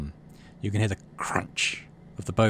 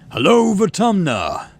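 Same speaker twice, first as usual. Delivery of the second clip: slow, booming, higher showman voice; booming announcement; 9 semitones higher, 8 dB louder, slower than usual. Acted. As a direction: boom it out like a governor on stage. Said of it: "His voice booms over the speakers".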